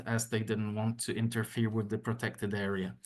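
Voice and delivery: usual speaking voice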